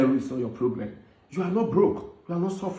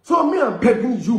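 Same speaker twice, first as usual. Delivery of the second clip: raised, pleading call; loud plea; 6 semitones higher, 4 dB louder than usual. Real, not acted. Peacemaker begging the quarrelling pair, loud plea.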